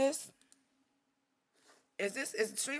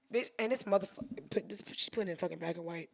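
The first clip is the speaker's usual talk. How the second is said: ordinary talk, nothing marked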